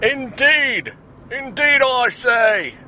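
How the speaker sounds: high, loud mock British exclamation; British accent. Host playfully echoing himself after naming The Who, mock British accent. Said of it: "that's my British accent".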